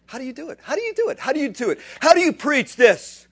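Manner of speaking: rising amazed challenge to the crowd; building exclamation. Real, not acted. Preacher asks, building passion.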